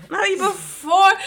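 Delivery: high, loud and slow disbelief; incredulous. Real, not acted. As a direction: stretch it out in disbelief. Incredulous retort to suggestion.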